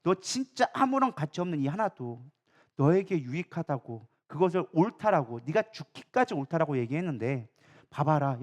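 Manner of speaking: low, soft, measured voice of God; hushed, solemn divine reasoning; God speaking. Acted. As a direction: plead quietly and earnestly, taking time. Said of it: "하나님의 시선을 보여주기 시작한 거야".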